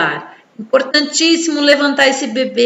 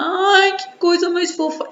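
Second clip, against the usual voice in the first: put-on high cooing baby talk; motherese; 7 semitones higher than usual. Shown as a demonstration. Mother cooing high at her cute baby. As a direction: gush over the baby up high. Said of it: "Esse mamanhês".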